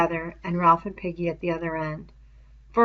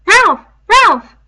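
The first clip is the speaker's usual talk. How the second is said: high, much louder frightened calling; frightened cry; 14 semitones higher, 18 dB louder than usual. A narrator's reading. Piggy waking Ralph in the dark, frightened loud cry. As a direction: cry out in alarm in the night. Boy cries out in dream.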